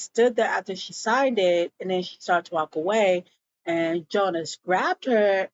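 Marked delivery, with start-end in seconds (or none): none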